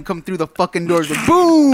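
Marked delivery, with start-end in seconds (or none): 1.3-1.7 booming door-burst sound effect; loud boom. Retold or imitated. Storyteller acting out Tim bursting through the door, loud boom. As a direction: shout a big punched boom.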